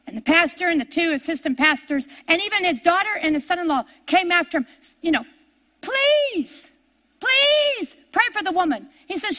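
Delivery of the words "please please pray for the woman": high begging please, voice pitched up; pleading. Retold or imitated. Pastors begging Smith to pray for the sick woman, high pleading. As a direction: beg him, stretched and high.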